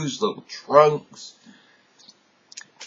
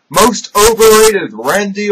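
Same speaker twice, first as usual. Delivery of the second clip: loud; 7 semitones higher, 9 dB louder than usual. Acted. Awards category announced loudly.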